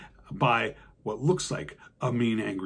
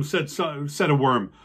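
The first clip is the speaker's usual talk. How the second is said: usual speaking voice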